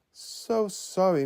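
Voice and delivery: slow, high, gentle sympathy. Acted. Misty consoling the disappointed girl, gently. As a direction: soften it for her. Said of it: "Misty said gently".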